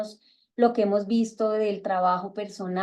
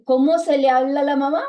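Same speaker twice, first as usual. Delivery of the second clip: put-on teaching prompt to children; 4 semitones higher, 7 dB louder than usual. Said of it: "yo les digo".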